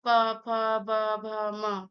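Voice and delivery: प, फ, ब, भ, म in even, separate syllables; labial letters demonstrated. Shown as a demonstration. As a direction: recite प, फ, ब, भ, म with both lips touching. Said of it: "जरूर स्पर्श हो रहा है".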